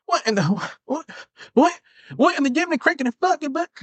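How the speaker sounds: shocked official yelping in disbelief; shocked outburst. Acted. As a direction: blurt it out in stunned outrage. Outraged official in skit.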